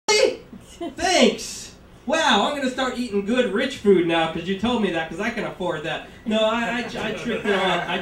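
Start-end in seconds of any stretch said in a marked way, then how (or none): 0.1-4.1 high, loud mock amazement; sarcastic. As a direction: sneer with fake wonder. Sarcastic reaction to Spurlock.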